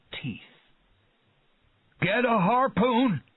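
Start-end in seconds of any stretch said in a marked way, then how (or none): 2.0-3.2 high, loud command; urgent cry. A narrator's reading. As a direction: yell the order in a hurry. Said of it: "cried Quint".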